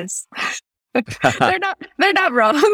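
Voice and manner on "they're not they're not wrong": high laughing agreement. Real, not acted. Guest laughing at the crime stories, high and giggly. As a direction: laugh it out, amused.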